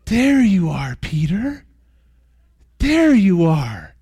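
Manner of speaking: hushed, slow, tender There you are; tender recognition. Retold or imitated. Lost boys tenderly recognizing Peter, hushed and slow. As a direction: breathe it out like finding a lost friend. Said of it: "And they go".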